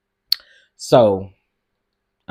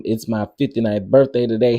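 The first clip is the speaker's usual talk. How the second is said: ordinary delivery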